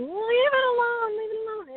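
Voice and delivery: high, loud yelling to scare off a predator; retold yell. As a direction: shout it while running to the rescue.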